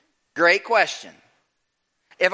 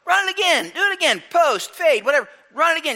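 high, excited calls to the field; coach calling out; 9 semitones higher, faster than usual. Retold or imitated. Coach on the practice field calling his player to run again, high excited calls. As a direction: shout it out like a thrilled coach. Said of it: "coach calling to player".

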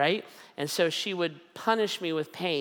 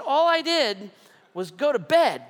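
indignant yell; 8 semitones higher, 6 dB louder than usual. Retold or imitated. Retold protest to wife.

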